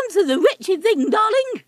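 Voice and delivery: high affected toff complaint; languid posh parrot. Acted. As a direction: play a bored upper-class twit. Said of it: "said Fweddy".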